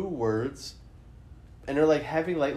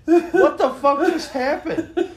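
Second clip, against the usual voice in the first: incredulous laughing; 9 semitones higher than usual. Real, not acted. Comedian reacting to an absurd music video, incredulous laughing. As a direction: crack up in astonished bursts, words pitched way up.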